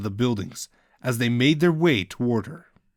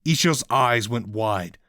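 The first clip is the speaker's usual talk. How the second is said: plain reading voice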